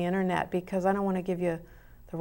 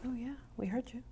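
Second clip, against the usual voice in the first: playful whisper into the mic; teasing stage whisper; 5 semitones higher, 9 dB quieter, faster than usual. Real, not acted. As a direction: whisper it back like a secret. Teasing reply after repeated phrase.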